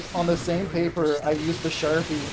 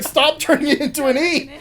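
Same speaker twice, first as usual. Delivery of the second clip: louder, higher exasperated shout; mock exasperated yell; 10 semitones higher, 7 dB louder than usual. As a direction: yell in fake frustration. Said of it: "she's like".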